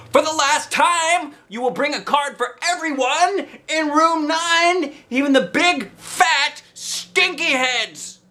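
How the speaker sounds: high, loud and slow, spelling it out; fed-up teacher laying down the law; frustrated teacher. A narrator's reading.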